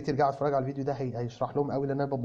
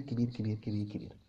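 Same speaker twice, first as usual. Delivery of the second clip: كبير repeated, fading to near silence; fading repetition; 6 semitones lower, 8 dB quieter than usual. Real, not acted. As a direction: let each كبير fade away softer. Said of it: "fading repetition".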